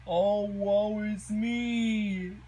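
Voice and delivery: high, slow, hammed-up wail of woe; melodramatic lament. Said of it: "Plankton's like".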